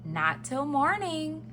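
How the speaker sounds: high, slow, gentle mother bear; Mama Bear. Acted. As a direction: answer slowly as a mother bear. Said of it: "said Mama Bear".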